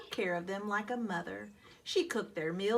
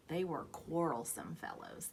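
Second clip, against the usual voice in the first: plain reading voice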